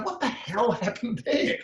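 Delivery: high-pitched, laughing disbelief; retold amazement. Trainer baffled by sudden tears, retold high amazement. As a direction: re-enact being astonished, voice shooting right up.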